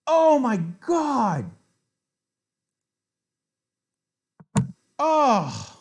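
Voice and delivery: very slow, high, loud Oh my God; long anguished scream; drawn-out screaming wail. Real, not acted. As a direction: stretch it out in agony. Accidental mouse-slip chess blunder.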